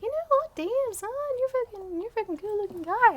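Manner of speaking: high, breathy swooning voice; mock swooning girl. Mocking her own heroine, swooning over the hot guy. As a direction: gush like a smitten girl. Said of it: "she's like".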